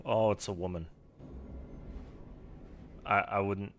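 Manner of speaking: normal talking voice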